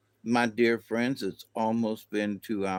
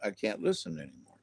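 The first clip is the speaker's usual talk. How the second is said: muttered aside; 7 semitones lower, 6 dB quieter, faster than usual. Retold or imitated. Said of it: "And then I was like".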